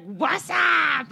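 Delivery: shouted high, cheery hello; loud goofy greeting. Acted. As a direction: yell a big cheesy "what's up". Acted greeting at the door.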